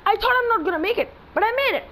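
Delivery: high, loud burst of surprise; can't believe it worked, blurt it out high; surprised relief. Real, not acted. Surprised he made the jump.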